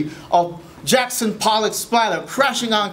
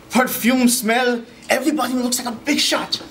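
ordinary talk

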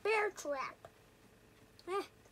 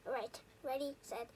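ordinary talk

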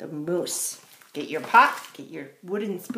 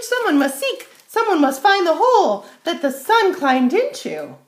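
high, loud, fast fox voice; urgent high-pitched yappy fox; clever fox; 9 semitones higher, 13 dB louder, faster than usual. A narrator's reading. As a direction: rattle it off as an excited fox.

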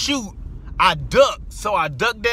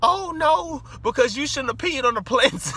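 high, sing-song refusal; mock cousin; 7 semitones higher than usual. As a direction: voice the cousin much higher, teasing.

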